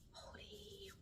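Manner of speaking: breathy stunned whisper, slow; awed whisper. Real, not acted. Thanking subscribers for five hundred, a stunned whisper. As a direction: barely voice it, genuinely awestruck.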